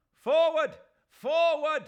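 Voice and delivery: high, loud rallying shriek. A narrator's reading. Mahmud Ali urging the crowd on, shrieked. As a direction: scream the crowd onward. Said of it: "shrieked Mahmud Ali".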